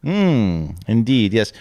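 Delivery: ordinary talk, nothing marked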